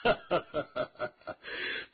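一连串短促的哈哈笑声; 说书人演出的得意大笑; 朗声笑. A narrator's reading. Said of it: "吴尘笑道". (哈哈笑出声)

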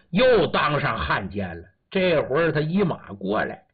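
ordinary delivery, nothing marked

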